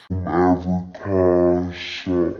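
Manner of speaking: slowed-down playback, very low and dragged; slow-motion effect; slow-motion replay. Shown as a demonstration. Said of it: "and then slow motion".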